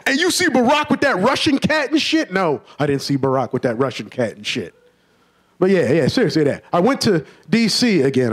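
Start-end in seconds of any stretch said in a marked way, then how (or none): 0.1-2.3 much higher mock excited question; eager friend. 2.3-4.7 lower, softer deadpan answer; flat annoyed reply. Retold or imitated.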